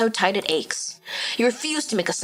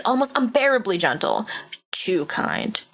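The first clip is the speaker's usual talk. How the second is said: usual speaking voice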